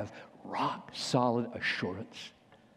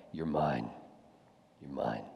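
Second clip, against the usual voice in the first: soft, low, intimate reassurance; tender inner voice; 11 dB quieter than usual. Pretend. The Spirit assuring the believer, softly tender. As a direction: murmur it like a loving father.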